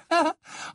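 short, high, affected laugh; airy little giggle; high tinkling laugh. Acted. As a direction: laugh lightly at your own remark. Fleur laughs at her remark.

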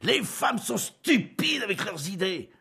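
slow, loud exasperated shout. A narrator's reading. Husband leaving the room, shouting in exasperation. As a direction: hurl it at her leaving.

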